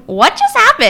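high, stunned disbelief; shocked. Retold or imitated. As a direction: squeak it out in shock. Shocked inner reaction.

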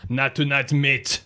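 lightly put-on French accent. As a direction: turn the bouncer's brush-off lightly French. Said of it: "told in a French accent".

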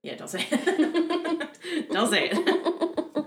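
slow, high words broken by laughter; laughing. Real, not acted. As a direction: talk while cracking up. Cracking up at friend's mispronunciation.

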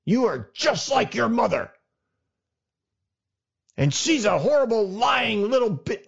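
a parent screaming insults at a child, acted out; parent yelling. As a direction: hurl it like a furious parent in a divorce. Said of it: "while they're yelling at you".

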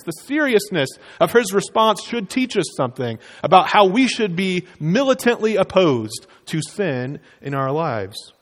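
ordinary delivery

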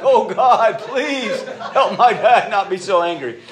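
put-on high, loud, slow pleading little boy; imitating a boy praying out loud to be spared; child's frantic prayer. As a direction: beg God like a scared kid, half laughing. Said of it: "praying out loud".

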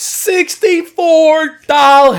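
very high, drawn-out incredulous cry; mock outrage. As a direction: shriek the price slowly in disbelief. Mock shock echo of price.

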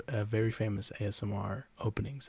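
ordinary talk

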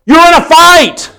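high, urgent pulpit cry; full-force preaching. Real, not acted. Preacher warning Christians they are in a fight, impassioned. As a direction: drive each warning home, pitch held high.

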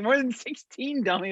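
high, mock teasing voice; high-pitched teasing imitation; mimicking friend's text. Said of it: "he's like".

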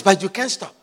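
normal talking voice

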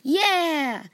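triumphant yell; victory scream. Acted. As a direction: scream it out of breath after winning.

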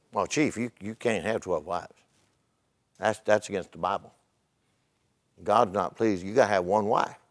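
low, soft missionary quote; quiet, low-pitched telling-off; hushed admonishment. Retold or imitated. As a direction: gently break it to the chief, very quietly. Missionary gently correcting the village chief.

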